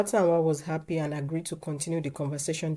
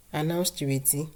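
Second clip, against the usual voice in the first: ordinary delivery, nothing marked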